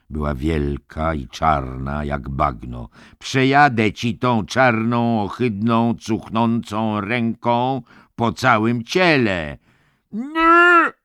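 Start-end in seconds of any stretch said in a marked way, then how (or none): 3.2-9.6 higher, loud, slow gloating threat; menacing orderly. A narrator's reading. 10.1-10.9 very high, loud roar. A narrator's reading. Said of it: "ryknął George".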